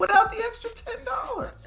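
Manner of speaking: very high falsetto mock worry; mock pity. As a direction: wail it in exaggerated fake concern, way up high. Sarcastic pity for a rich company.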